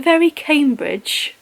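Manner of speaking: slow, high-pitched mock Cambridge English; badly faked posh Cambridge accent; attempted Cambridge accent. Shown as a demonstration. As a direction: stretch it out in a hammed-up Cambridge accent. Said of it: "that sounds nothing like it either".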